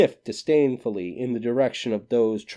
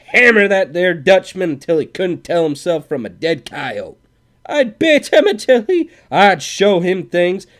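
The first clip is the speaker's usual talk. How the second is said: furious rant; 9 semitones higher, 12 dB louder than usual. A narrator's reading. Cowboy raging at absent Swede.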